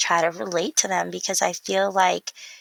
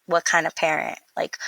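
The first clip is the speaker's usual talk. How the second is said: normal talking voice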